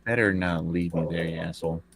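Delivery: ordinary delivery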